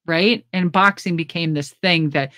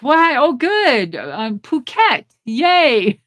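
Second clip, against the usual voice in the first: mock surprised delight; 6 semitones higher, slower than usual. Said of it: "And I'm like".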